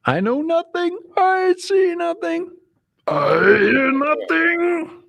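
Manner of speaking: very high, slow whiny Schultz voice; drawn-out comic German guard; Sergeant Schultz. Retold or imitated. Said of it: "renamed to Sergeant Schultz".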